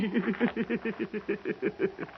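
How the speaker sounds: a sneering, contemptuous laugh; mocking laugh at Quince's threat; scornful laugh. Patchen scoffs at Quince.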